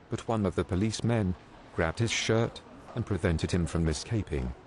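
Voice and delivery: normal talking voice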